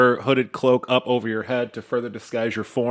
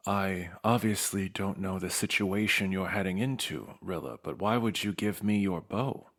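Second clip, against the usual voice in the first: lore master voice; 4 semitones lower, 10 dB quieter than usual. Acted. GM voicing Lore Master Holland.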